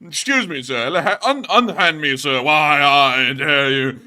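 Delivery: loud, hammed-up affront; theatrical arrestee, drawn out at the end; indignant protest. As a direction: protest grandly while being manhandled. Acting out a famous arrest rant.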